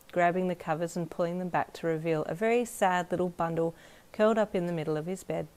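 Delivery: plain reading voice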